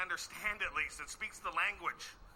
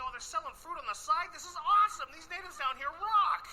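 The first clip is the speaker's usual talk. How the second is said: excited tourist; 10 semitones higher, 7 dB louder than usual. Retold or imitated. Comic imitating himself gushing at roadside sellers, excited. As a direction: gush like a thrilled tourist. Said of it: "and I'm like".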